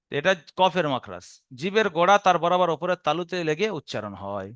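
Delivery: ordinary talk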